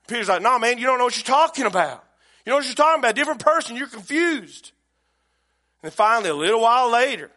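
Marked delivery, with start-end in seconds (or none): 0.4-4.7 loud, higher-pitched brush-off; acted denial. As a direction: protest loudly as the wrongly accused. Acted Peter denying the accusation.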